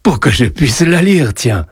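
grandfatherly amusement; amused. A narrator's reading. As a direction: tease the child with the obvious answer. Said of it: "répondit Joseph d'un ton amusé".

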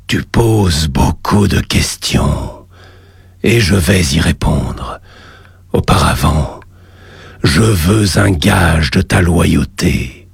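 deep, slow demon lord voice; low and slow, a voice from the depths; cavernous voice. Acted. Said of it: "une voix caverneuse".

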